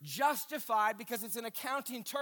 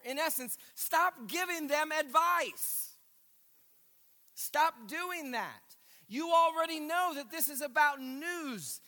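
raised, emphatic preaching voice; Paul admonishing; 5 semitones higher than usual. Acted. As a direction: press the correction home as the apostle.

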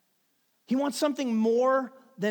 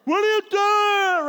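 loud, high, slowed parental yell across the field; half-laughing dad shout at his boy; yelling at son; 12 semitones higher, 10 dB louder, slower than usual. Retold or imitated. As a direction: call him out from the sidelines. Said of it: "I was yelling at him in the end zone".

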